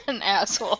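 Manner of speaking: real laughter through the words; helpless laughing insult. Real, not acted. Teasing a friend over the bad-parent joke, laughing.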